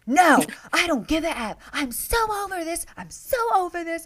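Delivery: high-pitched heated retort; mock yelling. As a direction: act out someone yelling, fed up. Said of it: "[name]'s just yelling".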